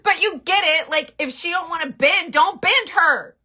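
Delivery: high, loud mock outrage; emphatic scolding. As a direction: drive it home loudly, drawing out the end. Mock-stern defence of the brush.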